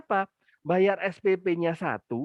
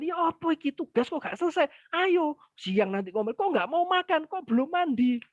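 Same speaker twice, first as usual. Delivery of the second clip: high, scolding mother's nagging; 10 semitones higher than usual. Retold or imitated. Mother nagging her child about chores, in a high scolding tone. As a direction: nag the child in a raised voice. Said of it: "Nggak perlu ngomel".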